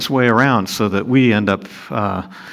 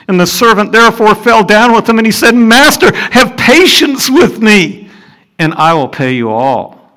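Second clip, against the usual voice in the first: higher, louder pleading read; fervent scripture reading; 8 semitones higher, 5 dB louder than usual. Acted. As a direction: plead it as the servant. Reading verse, pleading servant.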